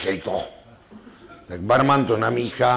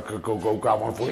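ordinary talk, nothing marked

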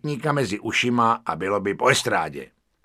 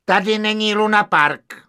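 high, loud dismissive waiter; 1 curt waiter; 10 semitones higher, 8 dB louder than usual. Acted. Waiter dismissing the narrator, loud and curt. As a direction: snap it like a bureaucrat.

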